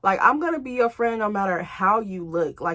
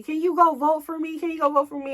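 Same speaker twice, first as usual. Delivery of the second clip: higher, pleading friend's voice; imitating Pearl begging; 8 semitones higher than usual. Retelling Pearl begging her for votes, pleading and high. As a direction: beg for the vote, pitched up. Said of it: "she was just asking me".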